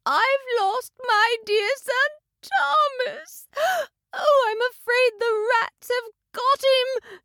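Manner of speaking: a mother cat crying over her lost son; weeping mother cat. A narrator's reading. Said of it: "shedding tears".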